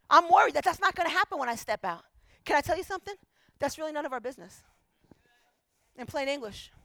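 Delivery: usual speaking voice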